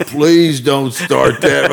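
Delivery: loud, drawn-out mock plea; retold pleading. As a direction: beg slowly and loudly, retelling it. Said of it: "And I'm going".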